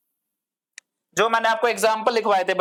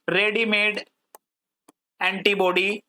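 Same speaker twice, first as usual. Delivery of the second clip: word by word with a long pause; slow dictation. Shown as a demonstration. Teacher noting a definition for students, paused dictation. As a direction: say each word separately, as if writing it.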